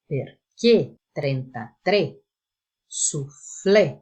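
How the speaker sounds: "Perché trentatré" with closed final E, slow; closed E examples. Shown as a demonstration. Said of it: "Vamos ver alguns exemplos". (E fechado em "Perché trentatré, soufflé")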